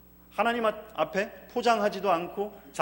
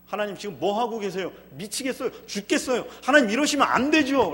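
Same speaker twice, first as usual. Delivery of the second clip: desperate prayer; 3 semitones higher, 7 dB louder than usual. Acted. Preacher voicing a believer's desperate prayer, building to loud cry. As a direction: plead harder and harder.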